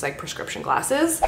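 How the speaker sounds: ordinary talk, nothing marked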